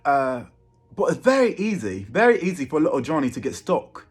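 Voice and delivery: high, fast put-on London accent; Little Johnny's high British accent. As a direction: rattle it off as a cheeky London lad. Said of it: "Little Johnny has a British accent".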